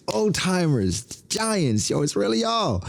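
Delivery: high, bright-eyed cartoon hero voice; excited Luffy. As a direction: greet old friends like a thrilled kid. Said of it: "He's like".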